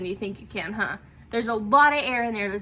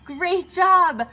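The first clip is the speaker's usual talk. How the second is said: bright burst of praise, louder and higher; enthusiastic praise; 7 semitones higher, 7 dB louder than usual. Real, not acted. Voice teacher praising students after the exercise, loud and excited. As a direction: cheer the class on.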